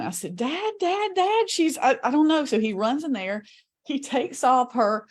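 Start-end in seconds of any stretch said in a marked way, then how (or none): 0.3-2.4 high, frantic call for help; screaming for dad. Retold or imitated. Daughter screaming for her dad as mom chokes, frantic. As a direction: yell for your father in panic. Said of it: "I'm screaming".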